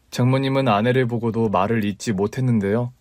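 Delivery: usual speaking voice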